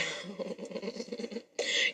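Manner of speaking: long, quiet chuckle; soft laugh. Real, not acted. Woman laughing at her date's retort, softly. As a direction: laugh softly under the breath.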